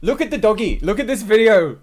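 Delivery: high, loud gushing; excited delight. Real, not acted. Streamer showing chat a dog video, excited delight. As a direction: squeal it to share your delight.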